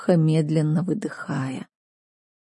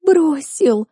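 high, tearful cry; sobbing; 9 semitones higher than usual. Acted. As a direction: sob the word out. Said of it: "сотрясалась от рыданий".

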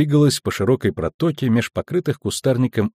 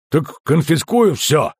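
slow, angry threat; angry bark; 5 semitones higher, slower than usual. A narrator's reading. Customs officer threatening the merchant, angry bark. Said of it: "зло рявкнул Ольсен".

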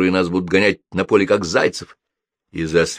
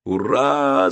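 raised, drawn-out hurrah; cheering yell; 4 semitones higher than usual. A narrator's reading. Brothers cheering as the engine starts, a raised happy yell. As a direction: give a moderate cheer. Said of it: "заорали братья".

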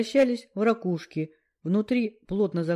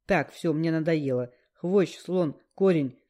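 plain reading voice

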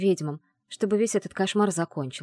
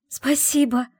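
high, louder burst of thanks; grateful exclamation; 7 semitones higher, 5 dB louder, slower than usual. Acted. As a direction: cry out thanks joyfully. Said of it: "воскликнула я".

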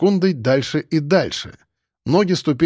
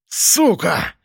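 roaring; 3 semitones higher than usual. Acted. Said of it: "заревел он".

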